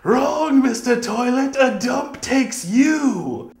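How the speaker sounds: full-force, high-pitched shout; loud, high comic-hero holler; crazed yell. Acted. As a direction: yell it wildly, as if throwing something off a train. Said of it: "throws it out of the train and yells".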